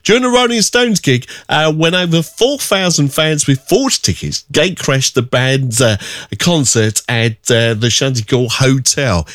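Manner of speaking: upbeat radio DJ read; radio announcer. Acted.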